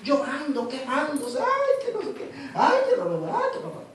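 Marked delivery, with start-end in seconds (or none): 1.4-3.8 high whining "ay" complaints, fading away at the end; mock mourner whining, fading out; mock lament. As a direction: whine like a weeping woman, hammed up. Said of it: "como esta llorona".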